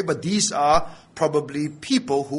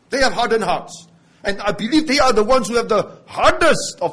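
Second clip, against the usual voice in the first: fervent preaching; 4 semitones higher, 4 dB louder than usual. Acted. Preaching at full force.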